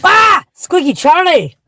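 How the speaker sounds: sudden high, loud startled cry of the cat's name; startled yelp. Real, not acted. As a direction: jump out of your skin and shout his name. Cat jumps onto the car.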